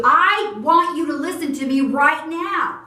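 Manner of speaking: demanding tone. Shown as a demonstration. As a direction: snap an order that grabs attention. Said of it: "what if I change the tone to".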